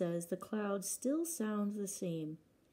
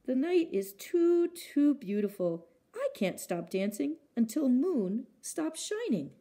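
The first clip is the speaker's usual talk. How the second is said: rapturous moth; 6 semitones higher, 6 dB louder than usual. Acted. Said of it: "said Moth".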